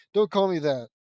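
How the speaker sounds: loud, firm objection; annoyed retort. A narrator's reading. Tsunade objecting to Naruto's nickname, annoyed retort. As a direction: cut off the nickname sharply and loudly.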